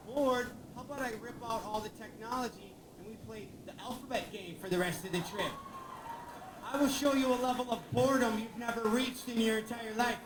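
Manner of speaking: loud, high furious outburst; angry dad yell. Retold or imitated. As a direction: blow up at the bored kid. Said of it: "I'm like".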